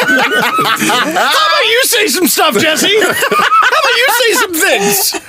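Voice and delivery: laughing, high and slow teasing; silly clown. Real, not acted. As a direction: giggle the question out playfully. Teasing co-host to talk.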